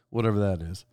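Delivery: ordinary delivery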